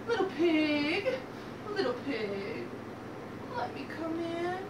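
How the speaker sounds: soft, drawn-out wolf's wheedle; coaxing big bad wolf. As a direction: lure the piglet softly, stretching each phrase. Wolf coaxes at the door.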